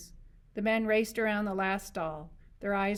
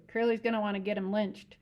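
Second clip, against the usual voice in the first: plain reading voice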